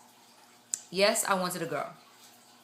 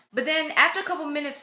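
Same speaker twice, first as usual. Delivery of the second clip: ordinary talk, nothing marked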